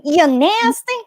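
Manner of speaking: imitating Raven. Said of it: "I remember her voice just saying".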